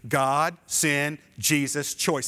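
God, sin, Jesus, choice chanted with the crowd; call and response. Shown as a demonstration. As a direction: lead the room through the four words. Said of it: "Would you say that with me?".

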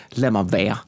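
low, subdued "leave me alone"; retold brush-off. Retold wish to be left alone.

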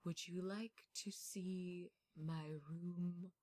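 slow, soft creepy butler voice; spooky butler. Acted. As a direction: hush it like a eerie butler showing his house. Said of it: "sounds like a spooky butler".

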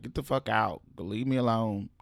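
normal talking voice

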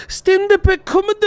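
put-on high puzzled Japanese accent; squeaky confused Japanese officer. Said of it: "It's so perfect".

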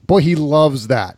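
usual speaking voice

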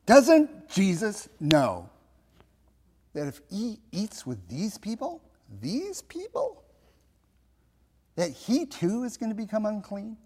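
mock-indignant grumble, hushed and rising high; complaining mutter. Preacher voicing Pharisees grumbling about Jesus, muttered complaint. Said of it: "But they're muttering".

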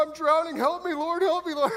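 high, whiny cry for help; high mocking wail of someone going under; mock drowning cry. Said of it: "You're like".

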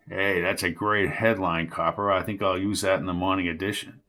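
a hint of a sneering old newsman; lightly voiced old reporter taunting Jones. A narrator's reading. Jerry mocks the cop.

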